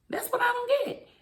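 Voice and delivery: high-pitched exasperated repeat; squeaky high disbelief. Real, not acted. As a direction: pitch jumps way up in baffled disbelief. Repeats line to stress confusion.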